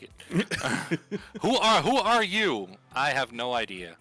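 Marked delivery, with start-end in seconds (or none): none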